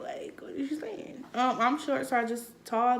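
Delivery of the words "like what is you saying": whispering. Real, not acted. Shy whispered aside.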